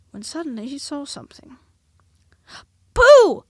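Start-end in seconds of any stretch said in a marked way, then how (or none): 2.9-3.4 very loud, very high cry; cried out. A narrator's reading. Piglet crying to Pooh, very loud high cry. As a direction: yelp out the name. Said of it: "he cried".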